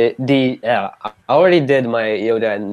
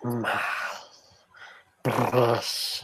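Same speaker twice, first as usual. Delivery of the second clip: Gollum voice; 9 dB quieter, slower than usual. Retold or imitated. Co-host's Gollum line.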